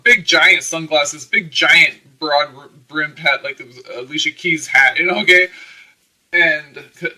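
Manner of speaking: rising excitement as she piles on details; excited. Real, not acted. Excited about hiding behind sunglasses.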